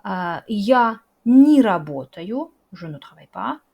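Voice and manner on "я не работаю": я не работаю said slowly and loudly, не as ni joined to the verb; slow clear model. Shown as a demonstration. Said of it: "se prononce comme ni". (я не работаю lent et fort, не prononcé ni, collé au verbe)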